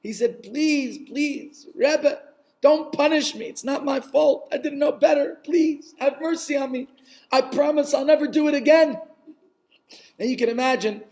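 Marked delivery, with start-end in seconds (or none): 0.4-9.0 high, urgent plea for mercy; begging and pleading. Acted. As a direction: beg the rabbi in a raised, desperate voice. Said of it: "begging, pleading, weeping".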